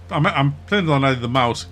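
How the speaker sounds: ordinary delivery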